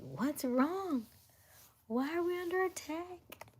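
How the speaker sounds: playing the startled ruler under attack; mock alarm. Mock alarm asking in role play why they're under attack.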